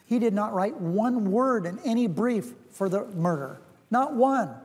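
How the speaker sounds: slow, deliberate insistence, each phrase set apart; emphatic denial. Real, not acted. Senator repeats that the nominee did not step into court, emphatic. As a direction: drive the denial home slowly, pitch lifted.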